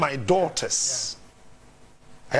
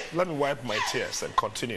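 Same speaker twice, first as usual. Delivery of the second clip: crying; 6 semitones lower, 6 dB quieter than usual. Real, not acted. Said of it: "You know why I'm crying?".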